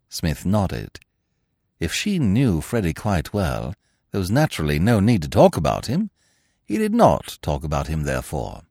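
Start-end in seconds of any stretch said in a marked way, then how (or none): none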